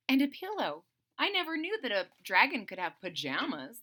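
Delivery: ordinary delivery, nothing marked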